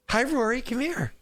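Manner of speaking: high, sing-song parent voice. Real, not acted. Dad to his young daughter on stream, high coaxing call. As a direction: coax a little one closer. Calling small child over to parent.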